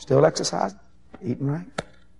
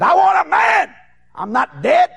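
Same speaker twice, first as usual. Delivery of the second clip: shouting woman; 14 semitones higher, 6 dB louder, slower than usual. Retold or imitated. Old woman demanding a man.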